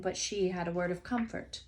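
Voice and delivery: ordinary delivery, nothing marked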